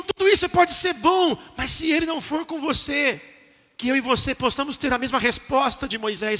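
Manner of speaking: ordinary delivery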